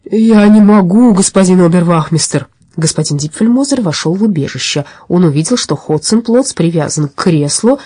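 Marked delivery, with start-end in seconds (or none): 0.1-2.5 pleading robber. Acted. Tied-up robber answering the policeman, pleading. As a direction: plead helplessly with the officer.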